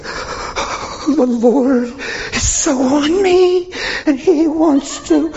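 high, slow, half-crying mock holy voice; mock overwrought church member. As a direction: ham up a tearful over-spiritual churchgoer, high and slow. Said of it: "they're like".

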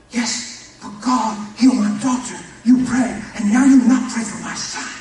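loud, high pleading protest in broken English; offended shout. Retold or imitated. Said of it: "he had broken English".

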